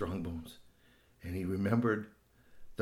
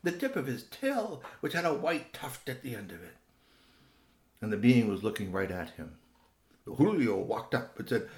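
plain reading voice

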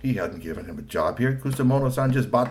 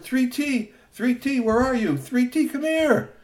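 high robot voice calling out; a robot calling for its friend; slower than usual. A narrator's reading. Robot searching, calling for Three T. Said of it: "Ayatsuri Ningyo Robot said".

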